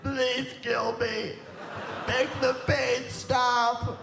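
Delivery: high, slow, pleading moan; old woman under the car begging, high and slow; dying old woman. Retold or imitated. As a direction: beg feebly for death. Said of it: "she looks at you and she says".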